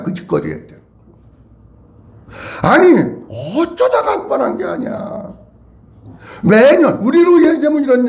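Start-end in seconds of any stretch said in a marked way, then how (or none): none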